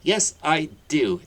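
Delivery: high, loud, slow shriek; screech. Acted. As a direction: shriek it back at him. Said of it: "Aunt Petunia screeched".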